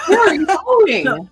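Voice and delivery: high laughing question. Real, not acted. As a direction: laugh through the words. Bird flies off, laughing.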